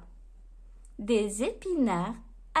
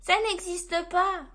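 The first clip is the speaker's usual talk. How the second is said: higher, lightly voiced little elf; 4 semitones higher than usual. A narrator's reading. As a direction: object as a slightly fretful elf. Elf objects to Santa.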